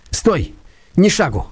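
high, sharp shouted command; desperate high cry after Stavrogin; shouting stop. A narrator's reading. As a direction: yell to stop him. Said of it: "крикнул он".

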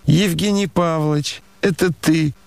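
high, ringing woman's cry; ringing call. A narrator's reading. Lady hails an acquaintance from a carriage, ringing high voice. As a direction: call out in sudden recognition on a high pitch. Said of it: "крикнул вдруг звонкий, прекрасный голос".

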